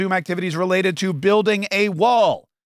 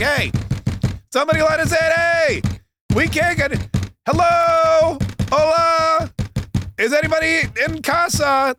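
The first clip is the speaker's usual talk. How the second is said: pleading shouts; 11 semitones higher than usual. Retold or imitated. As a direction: yell at a locked door, begging, much higher. Migrants pleading at shut border.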